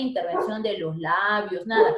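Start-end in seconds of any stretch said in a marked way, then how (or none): none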